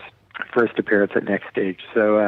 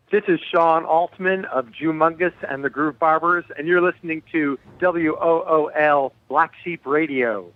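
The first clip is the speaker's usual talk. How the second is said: a station ID read in a higher, announcer-style radio voice; 6 semitones higher than usual. Shown as a demonstration. Asked by the host for a station ID, read as a radio announcer. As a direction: give the host a polished on-air station ID. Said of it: "can I get a station I D".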